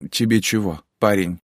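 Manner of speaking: the tired mother at the door, lightly voiced; mother's voice. A narrator's reading. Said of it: "спросила она". (голос усталой мамы)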